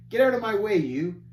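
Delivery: put-on a spoiled boy shoving past; gruff bratty child. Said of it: "in a very gruff voice".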